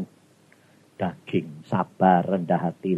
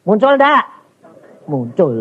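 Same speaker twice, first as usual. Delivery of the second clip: higher and louder, pressing for an answer; louder challenge; 5 semitones higher, 7 dB louder than usual. Real, not acted. Preacher pressing the congregation to answer, high and loud. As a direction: push the congregation to admit it.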